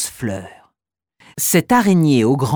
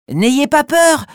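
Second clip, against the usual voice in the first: reassuring; 9 semitones higher, 4 dB louder, slower than usual. Acted. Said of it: "leur dit-elle".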